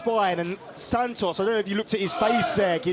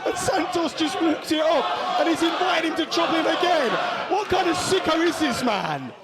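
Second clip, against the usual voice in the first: wrestling commentator; 7 semitones higher than usual. Real, not acted. Live play-by-play call.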